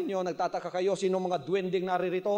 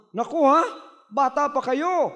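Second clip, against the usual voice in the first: higher, scolding man's voice; scolding passerby; 7 semitones higher than usual. Acted. Man scolding children caught lying, higher scolding voice. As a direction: chide the kids like a shocked passerby. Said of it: "Sabi nung mama".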